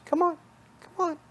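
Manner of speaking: high, squeaky-pitched bunny voice; little bunny rabbit. Acted. Bunny rabbit beckoning the listener to follow, high little voice. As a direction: pitch it way up as a tiny coaxing rabbit. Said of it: "the bunny rabbit turns and says".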